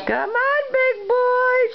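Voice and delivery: high cheering coax. Real, not acted. Cheering baby on.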